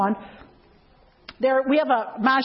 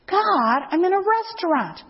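higher pleading objection; exasperated protest; 7 semitones higher than usual. Retold or imitated. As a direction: protest in disbelief, pitch up. Arguing with God's prompting.

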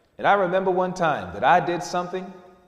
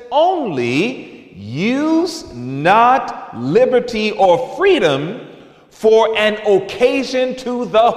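slow scripture emphasis; 4 semitones higher, 3 dB louder, much slower than usual. Acted. Preacher reading scripture slowly.

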